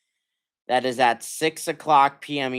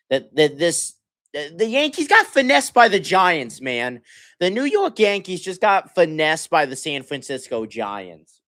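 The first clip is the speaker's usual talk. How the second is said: outraged rant; 7 semitones higher, 5 dB louder than usual. Real, not acted. Fan venting about a lopsided trade.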